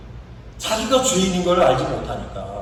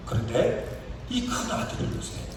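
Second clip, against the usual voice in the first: normal talking voice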